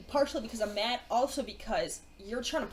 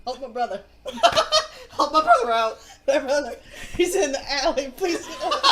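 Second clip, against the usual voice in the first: pleading through real laughter, louder; louder, laughing-through-words plea; 8 dB louder than usual. Real, not acted. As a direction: beg for your brother while laughing. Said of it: "I can't stop".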